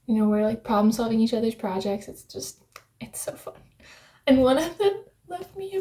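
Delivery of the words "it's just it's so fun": whispering. Real, not acted. Gushing about knitting.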